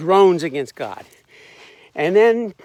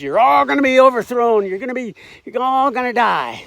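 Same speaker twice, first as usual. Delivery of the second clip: higher, louder doomsday proclamation; mock doom shout; 6 semitones higher, 4 dB louder than usual. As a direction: declare doom like a grim street preacher. Quoting Jonah's whole sermon.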